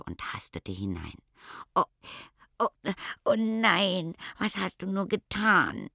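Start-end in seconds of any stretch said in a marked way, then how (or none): 1.7-5.9 hoch, jammernd und verzweifelt. A narrator's reading. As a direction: klag es hoch heraus, als wäre alles verloren. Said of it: "jammerte Stichkopf". (hoch und jammernd klagen)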